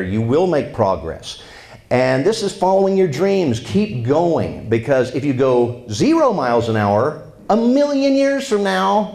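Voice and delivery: loud, earnest speaker's delivery; motivational speech. Acted. Speaker urging audience to keep following dreams, earnest. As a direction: urge the crowd on.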